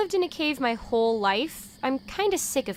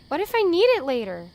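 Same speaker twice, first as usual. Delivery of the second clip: defensive; 6 semitones higher, 6 dB louder, faster than usual. Acted. Defending her trash collecting.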